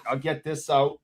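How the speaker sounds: normal talking voice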